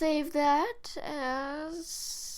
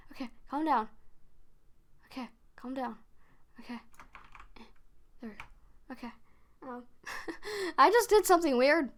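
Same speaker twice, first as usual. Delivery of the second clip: ordinary talk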